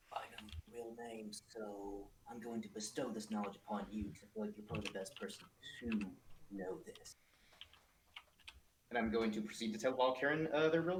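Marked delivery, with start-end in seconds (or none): 0.1-7.2 hushed whisper, confiding a secret; whispered aside to an ally; secretive whisper. Acted. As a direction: lean in and whisper it privately. Leaning over to share a secret.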